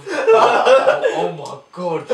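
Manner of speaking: high, loud yelp through laughter; retold pained cry. Boxer acting out being slapped on the leg, high laughing yelp. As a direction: laughingly cry out in mock agony.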